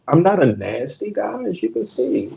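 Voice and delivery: ordinary talk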